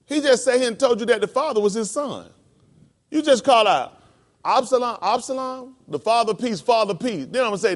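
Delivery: high, loud, fervent preacher's cry; preaching at full force. Acted. Preacher explaining David's cry to the class, fervent and loud. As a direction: hammer each phrase like a revival sermon.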